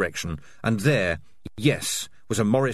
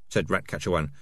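ordinary delivery

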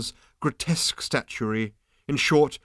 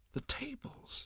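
whispered awe; 16 dB quieter, slower than usual. Acted. Awed hushed listing.

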